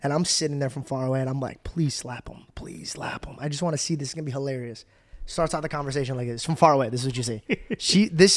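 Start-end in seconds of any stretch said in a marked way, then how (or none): 1.6-4.8 low, soft, near-whispered wish; root for it quietly, under your breath; hushed gleeful plea. Retold or imitated. Retold secret wish while watching afar.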